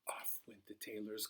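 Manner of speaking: whispered narration; breathy storyteller's whisper. A narrator's reading. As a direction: hush it like a secret.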